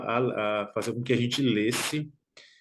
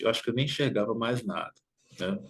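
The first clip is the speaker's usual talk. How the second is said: normal talking voice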